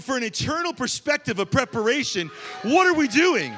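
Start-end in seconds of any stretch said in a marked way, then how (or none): 2.6-3.5 loud, high challenging question; preaching cry. Real, not acted. Preacher to the congregation, loud challenging cry. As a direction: call the congregation out.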